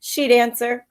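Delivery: lively read-aloud storytelling voice; story narration. A narrator's reading. Teacher reading a picture book to her pupils, lively storytelling.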